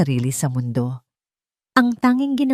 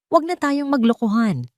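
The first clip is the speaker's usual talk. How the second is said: sharp shout, pitched up high; 6 semitones higher than usual. A narrator's reading. Silakbo confronting his mother over her lies, shouting. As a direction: shout it, done pretending. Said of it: "sigaw ni Silakbo".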